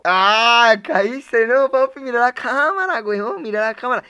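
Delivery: high, loud, hammed-up "gotcha"; mock prank reveal. Retelling how he told an angry friend it was a prank, loud excited bluff.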